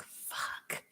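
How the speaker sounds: breathless whispered curse, barely audible. Retold or imitated. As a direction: mouth the swear in a voiceless whisper. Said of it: "I was like".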